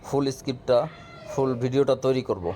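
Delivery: ordinary delivery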